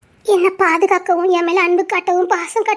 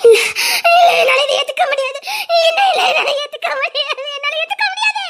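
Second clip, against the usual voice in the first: crying, rising higher as she repeats it; tearful refusal; 6 semitones higher than usual. Acted. Daughter rejects her newly revealed father, crying and pleading. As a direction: sob out the refusal, voice climbing.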